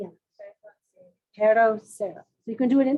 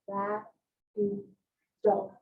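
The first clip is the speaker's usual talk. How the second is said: Rapido very slow, split into ra, pi, do; 2 semitones lower, 5 dB quieter, much slower than usual. Shown as a demonstration. Teacher shows pupils the syllables in Rapido, slowed down. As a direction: stretch Rapido syllable by syllable, with gaps. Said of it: "Slow down".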